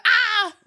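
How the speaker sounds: loud high "ah" squeal; sudden high cry, acting out a big laugh; demonstrative burst of noise; acted laughing outburst. Shown as a demonstration. Acts out her laughing slap.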